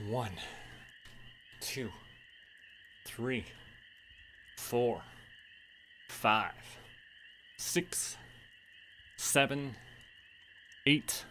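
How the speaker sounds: soft, strained counting while exercising; quiet breathless rep count; straining through squats. Real, not acted. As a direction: count the reps under your breath as you work. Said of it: "we're doing squats".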